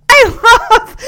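loud, high, laughing delight; cracking up. Real, not acted. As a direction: burst out loudly through laughter. Cracking up at the scene.

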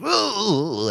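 high, far-back "ooh" noise; a quiet, high put-on ooh; imitated Killian Dane noise. Podcaster mimics Killian Dane, high soft ooh. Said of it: "Killian Dane was like".

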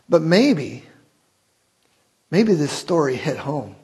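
normal talking voice

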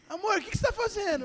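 wife catching husband mid-mistake, startled and fast. Retold or imitated. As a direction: blurt it out in alarm. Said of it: "ela falou assim".

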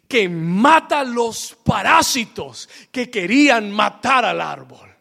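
slow, emphatic preaching, each phrase set apart; deliberate repetition. Real, not acted. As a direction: spell it out slowly for the congregation. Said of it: "se lo voy a repetir una vez". (repetición lenta y enfática)

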